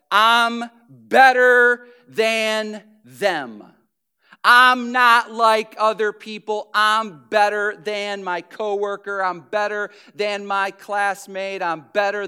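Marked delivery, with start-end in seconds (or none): 0.1-10.5 loud, very slow, drawn-out boast; slow, loud, smug brag; smug superiority. Retold or imitated. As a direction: preen like a Pharisee. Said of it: "holier than thou kind of mindset".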